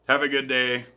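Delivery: usual speaking voice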